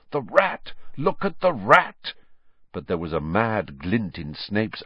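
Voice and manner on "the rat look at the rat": higher desperate cry. A narrator's reading. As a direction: plead frantically.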